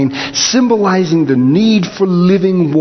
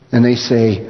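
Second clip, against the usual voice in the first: ordinary talk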